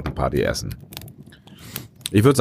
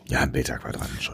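whispering; 6 semitones lower, 9 dB quieter than usual. Real, not acted. Whispered verdict in a taste rating.

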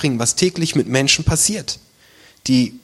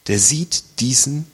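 ordinary delivery

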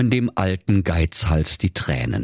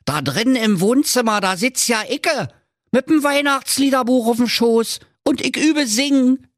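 high-pitched, astonished discovery; amazed old grouch; 3 dB louder than usual. Acted. Old miser sees his childhood self indoors, amazed. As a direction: exclaim in acted amazement.

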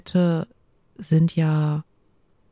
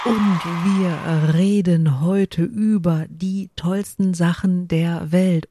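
slow, halting read-out; slower than usual. Shown as a demonstration. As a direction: read it slowly as if from a screen. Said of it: "Und ich spreche so komisch".

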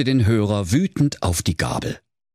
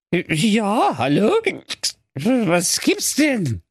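high, dragged-out tipsy character; drunken slur; 11 semitones higher, much slower than usual. Acted. Codekäppchen finally picks up the phone, tipsy high slur. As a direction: answer in a high, woozy slur. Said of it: "lallte das Codekäppchen".

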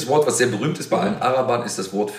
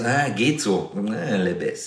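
noncommittal, drawn-out "meh" delivery; much slower than usual. Shown as a demonstration. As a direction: sigh it out like things are only middling. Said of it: "wenn man sagt so".

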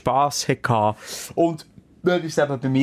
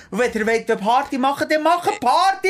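emphatic; 10 semitones higher than usual. Real, not acted. Emphatic host speech to guests.

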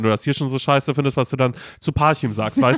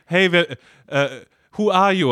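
a hint of a raised, startled guard voice, higher; mock guard; 6 semitones higher than usual. Joking about the music video, a lightly higher guard. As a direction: ham up the stranger who catches them.

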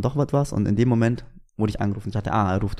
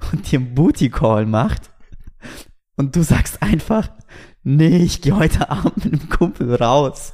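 higher, louder, slower disbelief; incredulous friend; 5 semitones higher, 5 dB louder, slower than usual. Retold or imitated. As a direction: hammer home how absurd it was. Friend incredulous about his choice.

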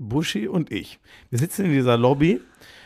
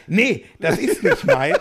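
higher, slower, laughing through a retold denial; laughing imitation; 7 semitones higher, slower than usual. Retelling Buschi denying the phone, laughing. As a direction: mimic the stubborn denial while cracking up. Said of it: "er hebt das Handy hoch und sagt".

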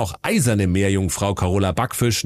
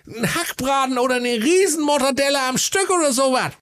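put-on high, goofy young prince; silly high prince voice. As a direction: play the dim prince, squeaky high. (alberne hohe Prinzenstimme)

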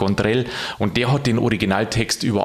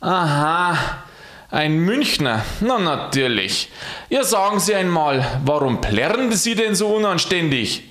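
measured, higher-pitched reproach; the good Lord; 6 semitones higher than usual. A narrator's reading. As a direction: question the offender from on high. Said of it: "dieser sprach".

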